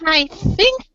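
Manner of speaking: very high, drawn-out gnome squeak. Acted. Gnome seamstress announcing her finished gloves, high gnome voice. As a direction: put on a slow, high-pitched little gnome. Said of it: "let me get my gnome voice on".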